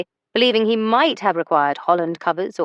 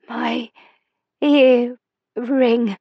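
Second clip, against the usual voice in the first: put-on halting, strained words from a frail old woman, a bit higher; effortful frail speech; 4 semitones higher, 4 dB louder, much slower than usual. A narrator's reading. Invalid grandmother pointing out the earring, halting and strained. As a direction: push each word out with effort. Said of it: "as she worked on speaking again".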